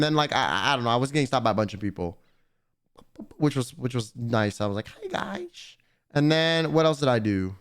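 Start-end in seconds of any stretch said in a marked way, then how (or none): 4.9-5.8 high cutesy greeting. Retold or imitated. Greeting fans at the con.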